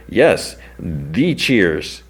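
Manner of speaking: "Yes, the Cheers" drawn out very slowly, leaning on "the Cheers"; slow emphatic. Retold or imitated. Talent coordinator insists on "the Cheers", very slowly. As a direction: savor "the Cheers" like it's famous.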